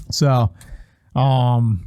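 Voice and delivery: ordinary delivery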